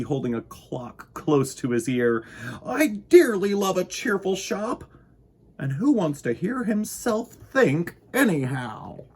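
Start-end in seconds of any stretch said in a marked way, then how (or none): 2.7-9.0 cheerful Winky. Acted. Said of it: "said Mister Winky".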